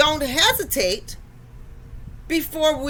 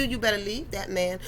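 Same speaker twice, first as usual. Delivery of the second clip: softer, lightly voiced grandmother; a hint of a scolding granny; 5 semitones lower, 8 dB quieter than usual. Granny warning her granddaughter about her man, lightly voiced. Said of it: "you can hear your granny voice telling you".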